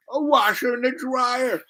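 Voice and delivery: high imitation of a girl in wonder; stammering mock astonishment, very high; amazed girl. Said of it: "She goes".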